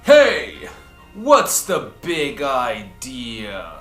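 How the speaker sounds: ordinary delivery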